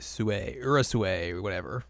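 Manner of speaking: ordinary delivery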